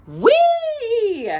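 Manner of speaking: high, loud, gleeful squeal; delighted squeal. Acted. Josephine squealing with joy at the clothes, high and gleeful.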